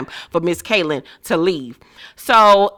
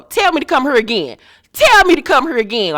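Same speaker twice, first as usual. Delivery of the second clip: higher, louder, fast mock yell; quoted yell; 7 semitones higher, 4 dB louder, faster than usual. Marie mock-yelling at Kaylin at brunch, loud and fast. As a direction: shout it back at her like Marie did.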